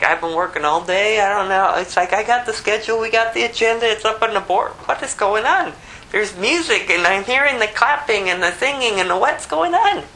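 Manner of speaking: high, put-upon complaining voice; annoyed elder son. Acted. Elder son grumbling about the music in the house. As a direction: whine about the noise.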